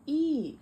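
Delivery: short high-pitched shriek; high scream. A narrator's reading. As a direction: squeal it out high. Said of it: "screamed Mercy Mala".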